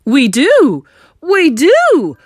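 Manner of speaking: high, very loud repeated cry; panicked cry. Acted. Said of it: "cried Mister Jenkins".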